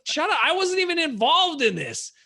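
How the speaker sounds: hammed-up high indignant yelp; mock indignant. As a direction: protest shrilly at being dragged in. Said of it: "I'm like".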